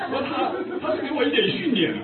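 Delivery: laughing. Real, not acted. Still laughing at memory.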